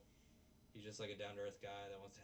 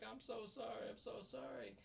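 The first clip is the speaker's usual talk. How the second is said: very high, put-on weepy voice; mock tearful apology; 13 semitones higher than usual. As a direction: imitate a girl crying as she apologises. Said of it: "she starts crying".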